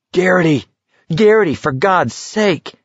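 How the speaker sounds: high, exasperated yell. A narrator's reading. McVries yells at Garrity fallen on his knees, urgent high yell. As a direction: holler his name urgently, pitched well up. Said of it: "McVrees was yelling".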